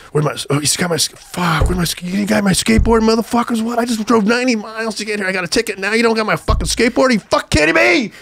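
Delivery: high, loud enraged shouting; asking friends about the lost skateboard, furious shouting; furious rant. Retold or imitated. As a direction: rage at the friends who left the board. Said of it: "I was fucking so pissed".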